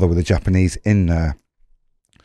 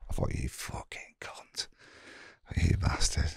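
a bitter hissed curse kept out of earshot; whispered insult; 6 semitones lower, 16 dB quieter, slower than usual. Retold or imitated. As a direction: whisper the insult under your breath. Insulting the boss under breath.

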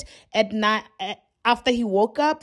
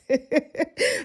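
laughing; 4 semitones higher than usual. Real, not acted. Laughs at mistake.

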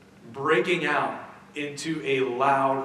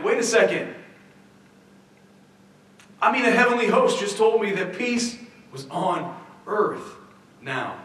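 high, louder sudden double-take; startled doubt; 5 semitones higher, 4 dB louder than usual. Retold or imitated. Shepherd stopping short, doubting what the angels promised. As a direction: catch yourself mid-thought.

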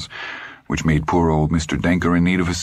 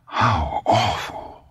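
breathless; 4 semitones higher, much slower than usual. Acted. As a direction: let the words go out on a gasp of air. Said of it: "Monica said breathlessly".

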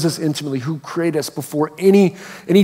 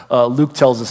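normal talking voice

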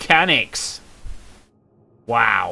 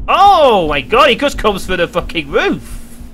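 startled shout; 7 semitones higher, 6 dB louder than usual. Real, not acted. Gamer yelling as the ghost comes through the roof. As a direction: yell as it drops in.

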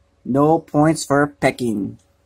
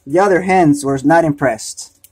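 ordinary delivery

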